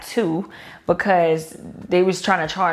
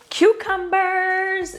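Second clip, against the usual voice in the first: high, slow drawn-out cucumbers; playful reveal; 11 semitones higher, much slower than usual. Pretend. Showing cucumbers to viewers, high drawn-out. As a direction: stretch it out high.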